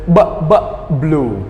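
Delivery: "b, b, blue" with separate lip pops building into the word; teacher drill; sound drill. Shown as a demonstration. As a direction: drill "b" twice then "blue". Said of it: "So we say b".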